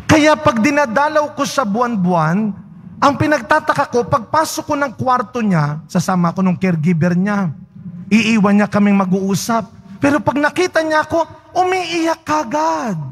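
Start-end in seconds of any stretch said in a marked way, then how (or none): none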